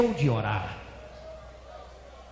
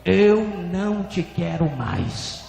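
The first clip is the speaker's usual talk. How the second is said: slow, louder pronouncement, heavy first word; drawn-out, firm and forceful; stronger divine voice; 3 dB louder, slower than usual. Retold or imitated. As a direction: repeat the verdict with more force. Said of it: "a voz repetiu mais forte".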